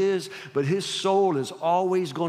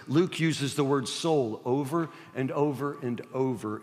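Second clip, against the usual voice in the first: ordinary talk, nothing marked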